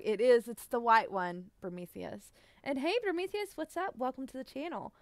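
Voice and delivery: ordinary talk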